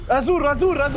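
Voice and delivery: excited repeated shout. Real, not acted. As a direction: blurt it out loudly. Shouting answers in a quiz race.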